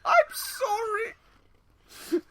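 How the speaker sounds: high, drawn-out whimpering sorry; mock crying apology. Cohost laughing, he whimpers a high mock sorry. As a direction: whine it like a fake sob.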